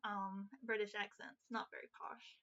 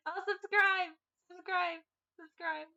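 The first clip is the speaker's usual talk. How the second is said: excited plea; 7 semitones higher, 10 dB louder than usual. Real, not acted. Cheery sign-off plea to subscribe.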